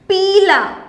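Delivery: पीला with a long, firm ee; पीला, लंबी ई ज़ोर से; long ee, stressed. Shown as a demonstration. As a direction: lean into the ee of पीला. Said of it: "उसको थोड़ा सा ज़ोर की बोलेंगे".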